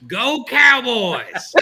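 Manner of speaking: stretched, loud mock Southern cheer; fake American accent. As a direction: ham up a Southern American yell. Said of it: "[name], can you sound like us".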